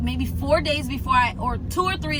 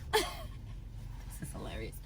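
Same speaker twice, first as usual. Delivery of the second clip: whispered aside; 4 semitones higher, 16 dB quieter, much faster than usual. Real, not acted. Laughing aside to viewers.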